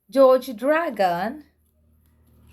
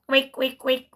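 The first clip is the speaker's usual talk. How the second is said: usual speaking voice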